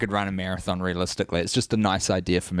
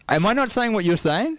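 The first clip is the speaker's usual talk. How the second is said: incredulous question; 12 semitones higher, 3 dB louder than usual. Real, not acted. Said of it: "frustrated at being corrected".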